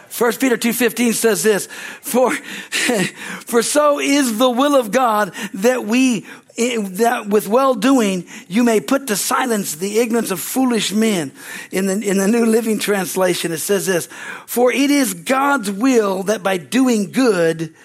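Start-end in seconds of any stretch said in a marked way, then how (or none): 2.0-11.3 slow, measured reading of the verse; scripture reading. Acted. Pastor reading First Peter to the church, slow and solemn. 14.5-17.8 slow, measured reading of the verse; scripture reading. Acted. Pastor reading First Peter to the church, slow and solemn. Reads the translation aloud.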